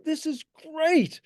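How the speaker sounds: slow, high, savoring delight. Retold or imitated. Bookseller thrilled by a rare photo, slow high delight. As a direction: relish each word like a thrilled collector.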